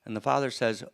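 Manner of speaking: ordinary delivery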